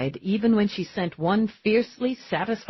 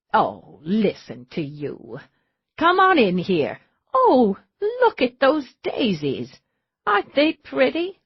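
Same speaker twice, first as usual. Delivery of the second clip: a lightly voiced sweet older woman, gushing higher at the end; doting older lady; 5 semitones higher than usual. Charity welcoming the killer at her door, lightly sweet. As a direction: fuss over him like a kind grandma. Old woman greets visitor.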